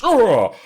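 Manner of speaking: loud, high straining groan; hammed-up exertion noise; effort grunt. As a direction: groan like a giant heaving himself up. Acted giant leaping over truck.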